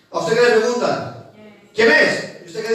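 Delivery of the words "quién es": high, loud call of "¿Quién es?" from inside; a mock homeowner calling through the door; asking who's at the door. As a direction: call out like someone behind the door. Voicing someone answering the door.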